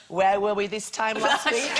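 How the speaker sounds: low, slow mock-wistful musing; wistful reminiscing. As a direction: sigh it slowly like holiday nostalgia. Holidaymakers musing back at home.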